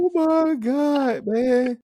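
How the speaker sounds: high, slow, loud amused groan; disbelieving laugh. Real, not acted. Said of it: "reacting to the clip".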